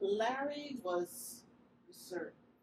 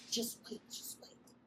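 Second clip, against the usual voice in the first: put-on hushed whisper to the kids; 11 dB quieter than usual. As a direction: whisper to keep the children calm. Telling the children to hold on.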